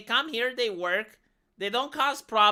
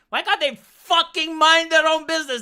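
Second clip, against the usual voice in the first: loud, higher, fed-up rant; exasperated outburst; 6 semitones higher, 7 dB louder than usual. Retold or imitated. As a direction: blow up at the busybodies. Ranting at imagined British newcomers.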